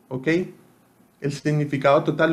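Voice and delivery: ordinary talk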